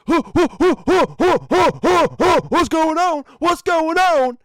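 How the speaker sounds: high, loud, breathless panting; panicked boyfriend. Retold or imitated. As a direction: gasp it out like a dazed, scared man. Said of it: "He just comes out like".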